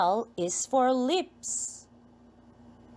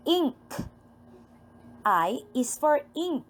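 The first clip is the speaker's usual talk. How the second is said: normal talking voice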